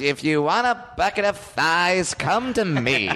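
Colonel Sanders voice. Pretend. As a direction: play the colonel selling chicken. Joins in as the colonel.

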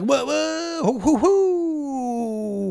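high, stretched panicked whos; nervous guy freezing up; frantic panic. Retold or imitated. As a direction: sputter in helpless panic.

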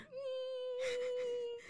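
wheezing laugh. Real, not acted. Helpless laughter.

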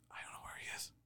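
panicked whisper; nervous ring announcer whispering ringside. Retold or imitated. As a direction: breathe it out in a panic.

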